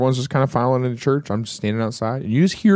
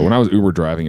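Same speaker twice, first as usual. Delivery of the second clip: usual speaking voice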